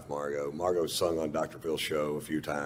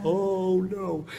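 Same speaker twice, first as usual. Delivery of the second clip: choked up; 12 semitones higher than usual. Retold or imitated. Moved to tears by singing.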